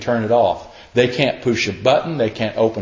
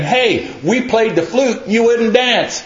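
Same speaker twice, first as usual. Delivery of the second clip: put-on kids yelling out; 10 semitones higher than usual. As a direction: call out like kids in the marketplace. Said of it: "the little kids are yelling out to them".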